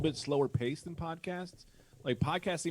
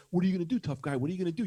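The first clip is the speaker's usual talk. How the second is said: retold taunting challenge; 5 semitones higher, 5 dB louder, much faster than usual. Neighbor squares up in retold confrontation.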